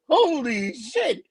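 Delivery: retold astonishment. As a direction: stretch both words in mock shock. Retold shock at the confession.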